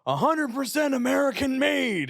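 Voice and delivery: hammed-up commercial slogan; mock ad claim. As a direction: parody the sales pitch. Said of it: "they're saying, like".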